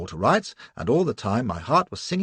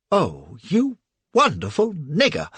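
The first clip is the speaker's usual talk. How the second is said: louder, slower delighted whoop; joyful shout; 5 semitones higher, 5 dB louder, slower than usual. Acted. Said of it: "I shouted".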